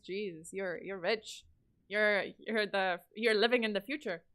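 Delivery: normal talking voice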